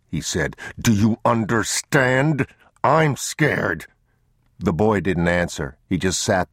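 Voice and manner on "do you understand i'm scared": raised, slowed, emphatic confession; scared insistence. Acted. Man squats before his sobbing son, insisting he's scared. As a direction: press the words on the child, pitch rising. Father breaks out at boy.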